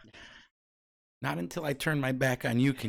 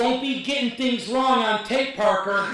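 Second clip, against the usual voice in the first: teasing scold; 9 semitones higher, 5 dB louder than usual. Real, not acted.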